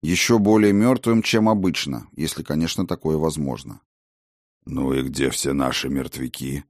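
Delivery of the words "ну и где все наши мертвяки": lower, quiet dragon voice; a grim dragon, muttering. Acted. Dragon asks about the dead.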